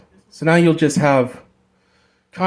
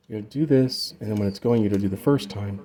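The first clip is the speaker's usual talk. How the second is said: usual speaking voice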